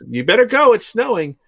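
high, light mimicry of his wife; imitating wife. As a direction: squeak it out like a nagging wife. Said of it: "my wife went".

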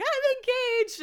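high squeal of I'm engaged; excited announcement. Retold or imitated. Friend flashing her ring, high excited reveal. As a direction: announce it with a giddy lift. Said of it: "It's like".

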